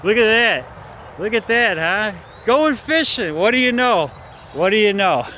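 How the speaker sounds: loud, high mocking remarks; scornful loud commentary; sarcastic jeer. Real, not acted. Mocking watchers aloud.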